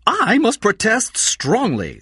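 high, slow put-on crook voice. Crook Score protests to detectives.

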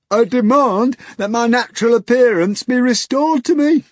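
high, loud pompous hog voice; hoarse grunt. A narrator's reading. The hog demands his form back, loud pompous grunting. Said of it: "the hog grunted hoarsely".

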